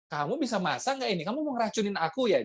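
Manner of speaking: high, sneering mock rudeness; mock accusing complaint. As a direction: play the tactless husband insulting the food. Rude husband complaining.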